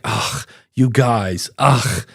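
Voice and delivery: slow, exasperated groan; mock-annoyed sigh; eye-rolling woman. Host mimics the morning zoo woman, exasperated. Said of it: "Usually it's like".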